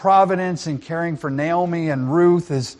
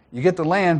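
usual speaking voice

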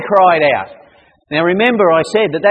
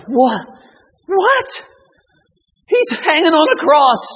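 incredulous, leaping up very high; astonished thought; 10 semitones higher than usual. Acted. Centurion thinks to himself on hearing the cry, astonished. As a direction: react in high-pitched disbelief. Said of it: "He thought to himself".

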